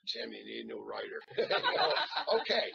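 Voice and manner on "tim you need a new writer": low, dry mock director; imitating John. Actor imagining his director's reply, low deadpan. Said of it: "I can see John going".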